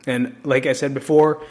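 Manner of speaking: ordinary talk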